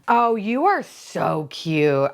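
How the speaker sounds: normal talking voice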